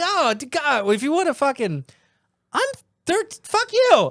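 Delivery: very high, sputtering outrage; pissed off. Retold or imitated. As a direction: sputter in hammed-up indignation. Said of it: "I was pissed".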